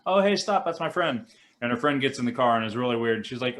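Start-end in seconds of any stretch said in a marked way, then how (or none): 0.1-1.3 lightly voiced girl calling out, higher pitched; excited teenage girl. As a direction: pipe up as a girl spotting her friend. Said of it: "she sees her friend ... and she's like".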